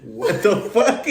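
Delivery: slow, loud, high incredulous laugh; laughing disbelief. Real, not acted. Reacting to co-host's kazoo comparison, laughing disbelief. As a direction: stretch it out, cracking up in disbelief.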